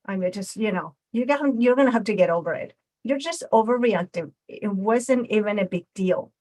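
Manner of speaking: defensive friend telling the upset one to get over it; aggressive, defensive. Shown as a demonstration. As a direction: snap back defensively and wave it away. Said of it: "He became aggressive when I said that, very defensive".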